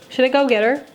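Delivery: usual speaking voice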